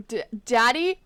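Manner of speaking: loud startled cry of daddy; shocked child. Acted. Child stunned to see father return, shocked cry. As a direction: gasp it out in shock.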